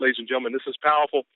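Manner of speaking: ordinary talk, nothing marked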